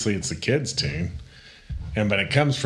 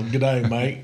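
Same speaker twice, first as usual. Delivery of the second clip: put-on Australian greeting; mock Australian accent; 2 semitones higher than usual. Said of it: "it ends up turning into Australian".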